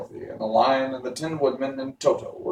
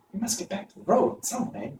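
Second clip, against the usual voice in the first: put-on girl's voice, pitched much higher; a young girl, higher and earnest; Dorothy voice; 9 semitones higher than usual. A narrator's reading. Dorothy to friends on the riverbank, higher and earnest. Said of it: "said Dorothy".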